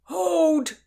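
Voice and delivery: high, loud cry of hold; guard cries out to stop the sword fight. Acted. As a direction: bark the order loud and high. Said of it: "cried the Tower".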